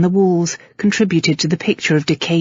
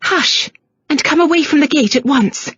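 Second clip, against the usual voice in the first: agitated mother; 9 semitones higher than usual. A narrator's reading. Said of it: "A woman's agitated voice".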